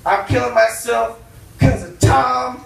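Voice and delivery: loud, high ranting suicide note; mock furious rant. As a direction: tear into Tom in accounting. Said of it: "I'd be like".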